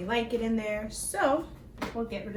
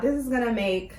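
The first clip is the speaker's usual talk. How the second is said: ordinary talk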